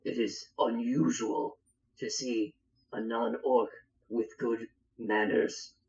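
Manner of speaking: a touch of a gruff orc. Acted. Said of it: "the orc says".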